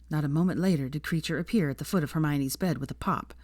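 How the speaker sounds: plain reading voice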